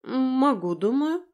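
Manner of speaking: high-pitched, hesitant answer; reply as if caught off guard; taken aback. A narrator's reading. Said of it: "Лера опешила".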